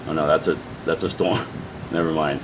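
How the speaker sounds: ordinary talk, nothing marked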